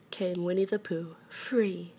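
ordinary delivery

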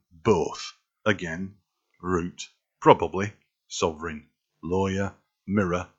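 Yorkshire English, short northern vowels; Yorkshire accent. Shown as a demonstration. Word list for viewers in Sean Bean's Yorkshire accent. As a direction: read each word like a Yorkshireman. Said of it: "I can do Yorkshire".

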